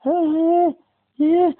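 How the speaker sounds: high, thin, exhausted voice; weak high voice. Retold or imitated. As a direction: barely get it out, drained. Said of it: "I can't talk. I'm weak".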